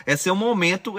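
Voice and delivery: usual speaking voice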